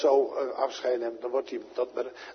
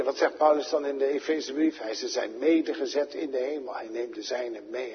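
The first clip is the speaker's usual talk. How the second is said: usual speaking voice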